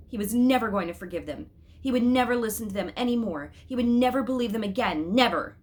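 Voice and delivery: rising anger. A narrator's reading. Narrator voicing the boy's furious vow, building. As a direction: hammer every never harder.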